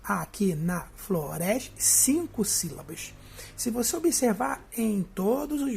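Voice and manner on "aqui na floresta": "Aqui na floresta" slow and lower, in detached beats up to "res"; syllable by syllable. Shown as a demonstration. Teacher counting five syllables, "Aqui na floresta" slow and detached. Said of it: "Então eu conto até aqui".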